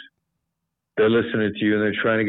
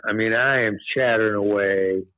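usual speaking voice

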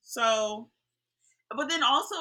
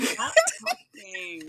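high, slow, laughing plea; laughing mock plea; much slower than usual. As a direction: beg them to stop between laughs. Laughing echo of quote.

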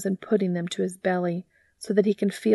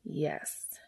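breathy whispered yes; barely voiced yes; 3 semitones lower, 11 dB quieter than usual. Real, not acted. Whispered aside before reading.

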